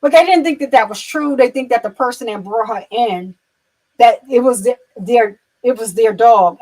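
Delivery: usual speaking voice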